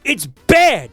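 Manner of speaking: rising exasperated rant; scathing critique. Real, not acted. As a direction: tear into the movie. Ranting about the movie.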